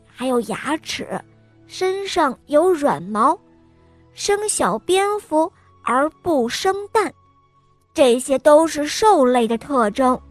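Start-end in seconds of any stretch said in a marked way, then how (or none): none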